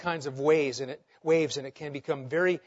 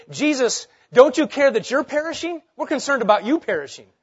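mock wrong quote; 6 semitones higher, 6 dB louder than usual. Shown as a demonstration. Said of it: "they didn't say".

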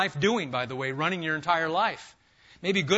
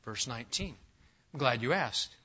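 normal talking voice

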